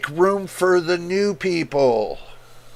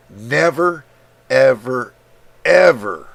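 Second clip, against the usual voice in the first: building louder on each ever; emphatic never ever ever; 4 semitones lower, 4 dB louder, slower than usual. Real, not acted. Streamer vowing to viewers, louder on each word. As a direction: swear it, stressing every word. Vows he never sells samples.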